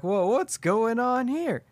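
high, slow put-on gruff woodsman; drawn-out surprised stranger in the woods; burly dude voice. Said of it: "He's like".

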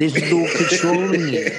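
words broken by laughter; laughing. Real, not acted. Laughing at the joke.

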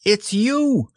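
high, fussy owl; bright know-it-all bird. Acted. Owl speaking.

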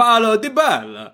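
very high, slow Japanese accent; mock Japanese accent, pitched right up; hammed-up Japanese accent, drawn out. Shown as a demonstration. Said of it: "Say it in a Japanese accent".

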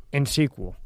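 ordinary delivery

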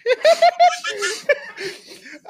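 high, loud helpless laugh; cracking up at the king dancing, loud high laughter; burst of laughter. Real, not acted. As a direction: crack up at the clip. Reacting to Charles dancing clip.

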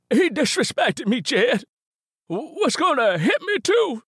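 put-on high, loud, aggrieved old man. Ben whining complaint to Jed.

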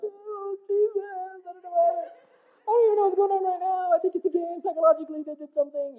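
high, teary voice, half laughing through sobs; reliving the cry in church, overwhelmed and confused; ugly crying. Retold or imitated. Alone at worship, crying and baffled at own tears, high and teary. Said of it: "'cause I ugly cry".

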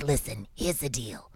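mock talent-manager voice; imitating the manager. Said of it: "he talked like this".

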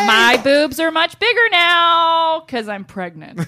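high, loud, slow chant; mock pregnant waitress. Pregnant waitress announcing herself to customers, mock high loud drawn-out call. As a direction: draw it out like a shouted server announcement.

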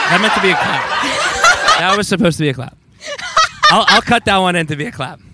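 talking through laughter; laughing. Real, not acted. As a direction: giggle through the words. Laughing at a botched clap.

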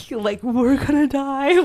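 hammed-up high, slow mock panic; mock scared ghost hunter. Amateur ghost hunters entering the house, mock dread. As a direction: play the scared amateur for laughs. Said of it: "they're all, like".

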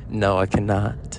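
low, firm celebrity impression. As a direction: refuse in a deep put-on voice. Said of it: "can you just imagine them at the door".

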